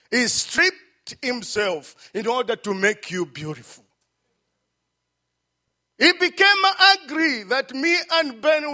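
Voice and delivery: loud, soaring preacher cadence; booming pulpit climax; full-force preaching. Acted. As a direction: preach it out to the congregation.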